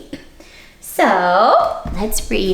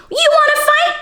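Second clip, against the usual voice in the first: squeaky, much higher, louder taunt; grouchy challenge; 5 dB louder, faster than usual. Acted. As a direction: dare them like a tiny bully. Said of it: "the grouchy ladybug".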